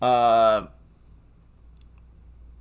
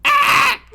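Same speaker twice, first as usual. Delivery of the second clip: high, short comic shriek; mock shriek; 10 dB louder than usual. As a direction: let out a squeal of pain. Shriek after punch.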